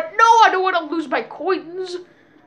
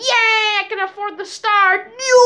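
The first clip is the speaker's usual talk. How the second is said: excited; 7 semitones higher than usual. Real, not acted. Excited kid playing Mario Party.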